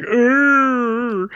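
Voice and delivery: high strained grunt through a held smile; mimicked grunt. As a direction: grunt like a smirking actor. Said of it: "He's like".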